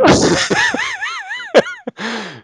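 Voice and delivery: cackling laugh; loud laugh. Real, not acted. Big laugh at Dave's complaint.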